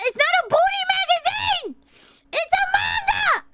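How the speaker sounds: high-pitched mock-indignant yell; defensive shout. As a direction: loudly set the record straight, flustered. Defensive yelling about manga.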